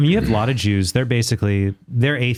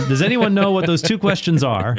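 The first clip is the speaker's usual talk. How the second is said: Rabbi Herschel Krustofsky; 9 semitones higher than usual. Retold or imitated. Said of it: "now I'm just Rabbi Herschel Krostowski".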